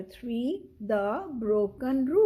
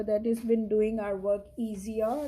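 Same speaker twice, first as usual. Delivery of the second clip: ordinary delivery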